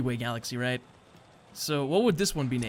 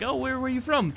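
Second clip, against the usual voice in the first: high-pitched mock question; curious questioner; 11 semitones higher than usual. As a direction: play a curious stranger asking, pitched way up. Acted exchange with galaxy traveler.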